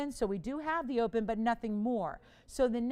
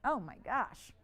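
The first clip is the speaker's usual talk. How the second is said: ordinary talk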